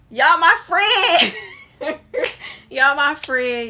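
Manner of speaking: cackling thank-you to the viewers; laughing shout. Real, not acted. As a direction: burst out laughing as you call them your friends. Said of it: "laughing shout to viewers".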